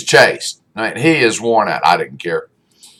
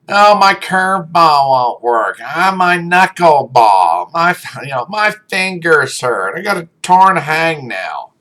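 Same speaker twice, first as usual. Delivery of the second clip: higher, slow mock whine about every little ache; whiny kid; 6 semitones higher than usual. Coach imitating his whiny pitcher's excuses, mocking whine. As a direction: mimic the kid pitcher's whining, drawn out and higher. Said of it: "but he was a whiner".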